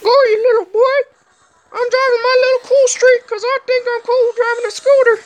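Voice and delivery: high squeaky mocking little-boy voice; squeaky cartoon kid voice. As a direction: squeak it out like a smug kid on a scooter.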